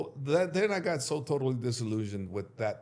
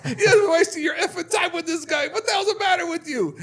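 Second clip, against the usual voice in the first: high, loud exasperated yell; cussing brother; 9 dB louder than usual. Retold or imitated. Brother cussing him out over the fees, high shout. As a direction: berate him, shouting and cracking. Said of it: "he starts cussing".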